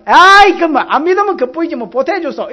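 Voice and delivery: sharp, loud mocking retort; arguing with a scoffer while evangelising, loud and high; loud jeer. Mocking those who sneer at believers.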